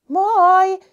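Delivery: high, loud cheerful call; shouted hello. Acted. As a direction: call out to the other mice. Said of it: "huusi pikku hiirulainen". (iloinen huuto)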